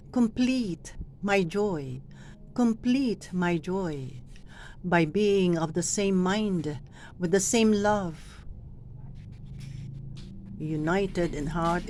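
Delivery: complete stretched and slow, a pause after the phrase; stress on complete. Shown as a demonstration. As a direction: lean into complete and hold it. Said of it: "when you emphasize the word or stress the word".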